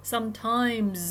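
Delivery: "sometimes" with the closing ms voiced as a long mz; vibration carried on. Shown as a demonstration. Teacher showing the rule for final s, "sometimes" ending in voiced z. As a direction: let the m of "sometimes" buzz on into z. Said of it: "ต่อไปเลย".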